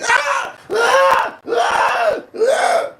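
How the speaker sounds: high repeated screams of a woman; imitated yelling. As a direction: scream out each ah, high and sharp. Yelling and, yeah, just going crazy.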